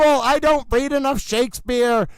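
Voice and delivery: normal talking voice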